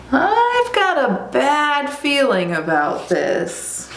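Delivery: high, slow owl voice; worried owl. Acted. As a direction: draw it out high with foreboding. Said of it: "What's our owl say?".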